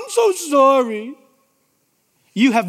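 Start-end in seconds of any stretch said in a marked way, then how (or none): 0.0-1.1 mock whiny apology. Mocking shallow apology again.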